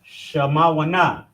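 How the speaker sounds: Shamawana said slowly, syllable by syllable; slow, drawn-out number word. Shown as a demonstration. Teacher modelling a Hebrew number slowly. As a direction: stretch Shamawana out slowly for the learner. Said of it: "Eight, Shamawana".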